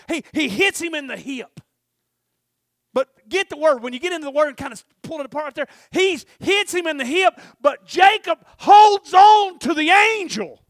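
loud, high preaching cry; full-force preaching. Acted. Preacher calling the church to Jacob's story, loud and high. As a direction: preach it hard to the congregation.

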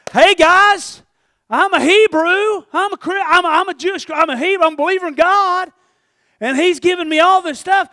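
loud, high mock announcement of faith; hammed-up bragging shout to the crowd; mock proclamation.

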